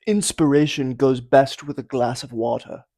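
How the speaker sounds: loud mock-advert proclamation; inspirational intro. Host trying another opening line, loud and mock-inspirational. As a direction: announce it grandly, like a slogan. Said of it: "which one of those you think was the most inspirational".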